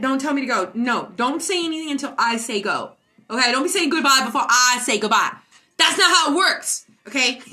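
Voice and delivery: loud, emphatic telling-off; mock scolding. Streamer telling viewers not to say goodbye early, mock scolding.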